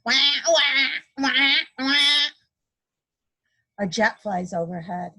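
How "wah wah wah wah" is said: higher, louder wah wah crying; mock baby cry. A narrator's reading. Mom imitates a crying baby, mock wail. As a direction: play a wailing baby, lightly. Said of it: "A baby crying".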